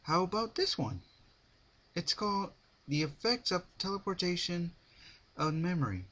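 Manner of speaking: put-on high, bright-eyed pony girl voice; bouncy Pinkie. A narrator's reading. Pinkie offers a book.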